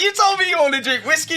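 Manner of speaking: high laughing protest; laughing disbelief. Retold or imitated. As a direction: squeal it out in disbelief.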